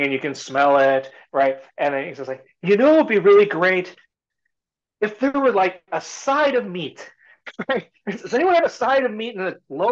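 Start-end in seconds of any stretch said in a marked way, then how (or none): 2.6-9.3 much higher, louder playful ask; hint greedily for meat; coaxing stranger. Retold or imitated. Stranger teasing the crowd for a side of meat.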